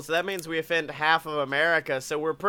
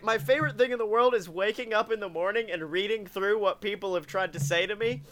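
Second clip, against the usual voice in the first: ordinary talk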